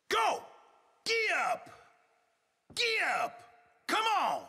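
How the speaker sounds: high, loud riding calls; urging shout. Acted. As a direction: holler short commands to spur a horse on.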